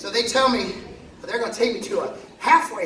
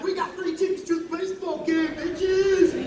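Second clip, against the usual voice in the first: excited cheer; 8 semitones higher than usual. Retold or imitated. Cheering at game.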